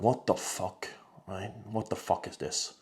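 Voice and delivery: low, hushed disbelief; exasperated aside. Retold or imitated. Man reacting to draining people, exasperated mutter. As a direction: mutter it under your breath in dismay.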